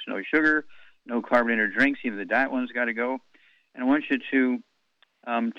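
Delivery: ordinary talk, nothing marked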